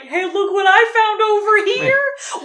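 high, loud, drawn-out mock announcement; mock excited call. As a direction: sing out the discovery, hammed up. Acting out waking the friend.

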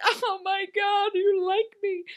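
high, tearful mock gush; mock Oscar acceptance. Winner accepting an Oscar, mock tearful gushing. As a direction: cry out slowly in hammed-up awe. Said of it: "accepted an Oscar".

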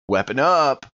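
normal talking voice

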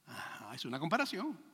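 ordinary delivery

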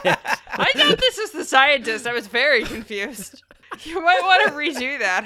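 talking through laughter; laughing. Real, not acted. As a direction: giggle while you speak. Player laughing at mixup.

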